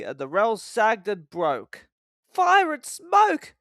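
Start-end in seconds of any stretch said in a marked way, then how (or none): 2.3-3.6 much higher, louder, slow alarm; alarmed exclamation. A narrator's reading. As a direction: cry out in dismay. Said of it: "said Thomas".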